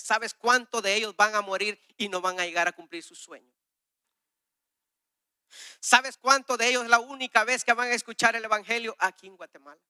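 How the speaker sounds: preacher voicing God's urgent call, loud; urgent proclaiming. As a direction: drive the question home with fire. God's urgent message retold.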